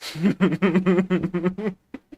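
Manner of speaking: helpless run of chuckles; giggle. Real, not acted. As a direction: burst into a rolling giggle. Laughs at her model's face.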